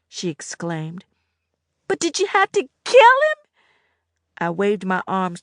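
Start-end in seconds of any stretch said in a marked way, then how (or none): 1.9-3.4 much higher and louder; distraught young girl. A narrator's reading. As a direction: beg to understand. Annette's voice.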